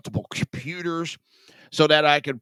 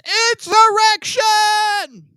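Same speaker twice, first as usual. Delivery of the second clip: high, drawn-out mock shriek; mock outrage; 9 dB louder, much slower than usual. Host mocking Democrats' alarm over rebellion at home, mock outraged shriek. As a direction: wail it loudly, stretched out in exaggerated alarm.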